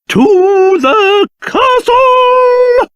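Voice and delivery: high, long-drawn battle cry; rallying cry. Acted. King urging the party homeward, rousing cry. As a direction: stretch every word into a rousing call.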